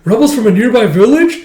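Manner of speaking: high, loud worried guess; alarmed question. Acted. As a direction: ask it in a rising panic. Voicing an alarmed soldier.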